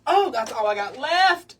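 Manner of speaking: loud, high cry of dismay; dismayed exclaim. Real, not acted. Crafter realizing her favourite ribbon is used up, loud dismay. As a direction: yelp as you see it's nearly gone.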